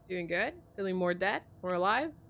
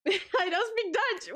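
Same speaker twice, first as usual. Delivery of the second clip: much higher, slow, through a laugh; 11 semitones higher, 6 dB louder, slower than usual. Acted. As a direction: giggle the line out in disbelief.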